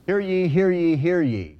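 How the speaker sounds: loud, ringing court crier call; town crier. Real, not acted. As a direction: call out hear ye to the whole courtroom. Court crier opens the session.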